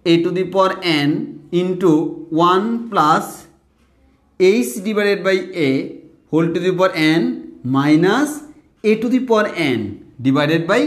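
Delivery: slow, word by word, each term spelled out; slow dictation. Shown as a demonstration. Teacher slowly dictates a formula to students, word by word. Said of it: "আমি আস্তে আস্তে বলতেছি".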